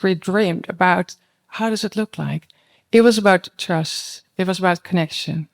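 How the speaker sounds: ordinary talk, nothing marked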